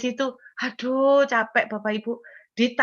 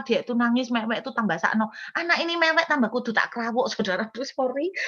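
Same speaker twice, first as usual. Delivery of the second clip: ordinary talk, nothing marked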